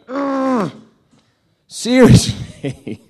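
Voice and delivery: high groan into a loud, drawn-out complaint; mock exasperation. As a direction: groan in hammed-up disbelief. God exasperated by forgetful people.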